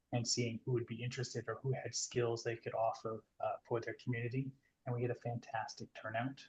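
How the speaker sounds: quiet, drifting away from the mic; faint voice, far from the mic; fading out. Real, not acted. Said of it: "you're actually fading out a little bit".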